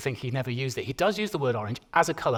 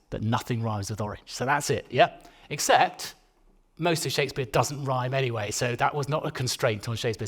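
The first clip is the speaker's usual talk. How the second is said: ordinary talk, nothing marked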